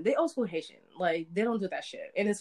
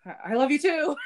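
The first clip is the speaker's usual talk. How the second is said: higher, halting dad saying it back; a stumbling, shy father; imitating dad; 8 semitones higher, 5 dB louder than usual. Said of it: "he was just like".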